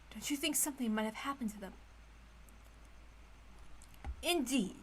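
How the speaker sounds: usual speaking voice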